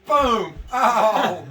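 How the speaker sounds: loud sudden cry, boom then oh; mock yelp of pain. Retelling the hospital to friends, loud pained yelp. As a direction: blurt it out like it just got yanked. Acting out the catheter pulled.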